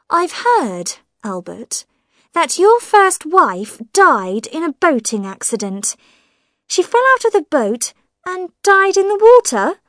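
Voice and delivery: high, probing voice of a suspicious woman; prying sister-in-law. Acted. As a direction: needle him with pointed questions. Millicent voiced in the story.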